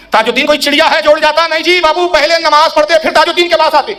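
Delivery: high, forceful rebuke, imitated; retold saintly wrath. Saint rebukes a visitor who skipped prayer, retold wrath. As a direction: scold like a displeased holy master. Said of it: "آپ جلال میں فرماتے".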